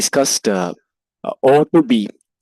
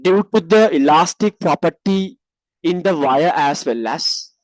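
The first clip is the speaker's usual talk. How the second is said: dictating. Shown as a demonstration. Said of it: "write like this".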